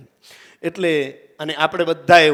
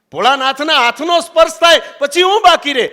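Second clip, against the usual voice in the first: emphatic repeat; 8 semitones higher, 6 dB louder than usual. Shown as a demonstration. Rhetorical question repeated for emphasis.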